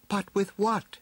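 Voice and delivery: high-pitched puzzled heroine; high heroine's question; storybook heroine. A narrator's reading. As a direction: ask it in a high character voice. Heroine's line in story dialogue.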